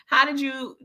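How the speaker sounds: ordinary talk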